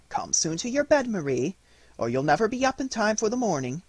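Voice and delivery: high, called-out motherly voice; mother calling. Acted. Mother calling Marie to bed as she leaves, high call. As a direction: call her to bed from the doorway. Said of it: "cried her mother".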